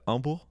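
"humble" said as "umble"; dropped H. Retold or imitated. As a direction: start "umble" straight on the vowel. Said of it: "who would say umble".

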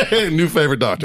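repeated through laughter; laughing. Real, not acted. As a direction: say it again while laughing.

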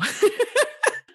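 high rising laugh of disbelief; amazed laugh. Real, not acted. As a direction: burst into a real high laugh. Host reacts again, amazed.

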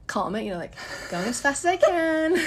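normal talking voice

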